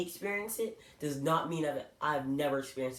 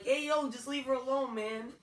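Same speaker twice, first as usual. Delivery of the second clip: high, raised voice telling him to back off; calling out firmly; 9 semitones higher than usual. Retold or imitated. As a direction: step in and call him off, pitched up. Stepping in to defend girl.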